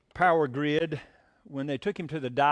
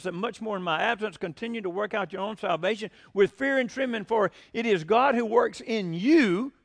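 higher, loud scripture proclamation; fervent preaching; 6 semitones higher, 3 dB louder than usual. Acted. Pastor quoting Philippians to his flock, fervent preaching. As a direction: declaim the verse to the congregation.

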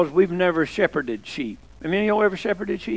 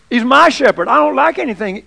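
preaching loud; 5 semitones higher, 6 dB louder than usual. Real, not acted. Preacher's climactic refrain.

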